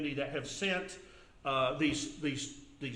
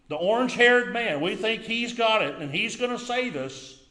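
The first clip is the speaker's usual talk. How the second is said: sarcastic preaching; 6 semitones higher, 8 dB louder than usual. Acted. Preaching sarcasm about candidate.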